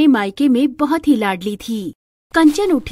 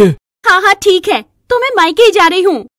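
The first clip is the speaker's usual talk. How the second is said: higher, louder huffy reply; wife storming off to her parents, loud; angry retort; 7 semitones higher, 7 dB louder than usual. A narrator's reading. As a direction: flare up and storm off.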